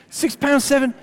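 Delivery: playfully astonished at the figure; mock amazement. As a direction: repeat it in comic disbelief. Marvels at tiny birth weight.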